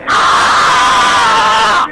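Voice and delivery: raw scream of pain, full voice; loud pained scream. Real, not acted. Man being restrained screams in pain. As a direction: let out a real cry of pain. Pain during arrest struggle.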